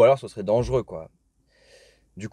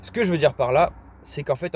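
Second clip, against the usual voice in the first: louder and higher, over traffic noise; louder restart; 5 semitones higher, 4 dB louder than usual. Real, not acted. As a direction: restart the phrase with more voice. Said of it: "j'attends que les voitures passent".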